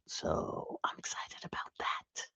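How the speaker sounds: soft unvoiced whisper. Real, not acted. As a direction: whisper it slowly, like a secret. Whispered aside about profit.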